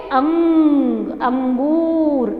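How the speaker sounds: "अंग, अंगूर" with a long hummed nasal; nasal sound demo. Shown as a demonstration. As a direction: stretch the ng in "अंगूर" very slowly. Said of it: "तो हम इसे कैसे बोलेंगे?". ("अंगूर" में अनुस्वार को खींचकर बोलना)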